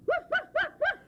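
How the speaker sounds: very high yappy woofs; dog barking. Pretend. Child barks as the dog in the story. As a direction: yip like a tiny puppy. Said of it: "The dog barked".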